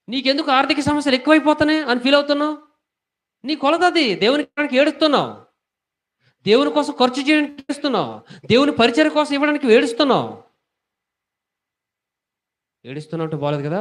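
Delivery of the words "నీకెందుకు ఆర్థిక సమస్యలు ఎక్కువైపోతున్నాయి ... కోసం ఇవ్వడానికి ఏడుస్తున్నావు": high, loud rebuking sermon voice; full-force preaching. Acted. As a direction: drive the rebuke home from the pulpit. Pastor rebukes congregation on giving. (గట్టిగా, ఎత్తైన స్వరంతో గద్దించే ప్రసంగం)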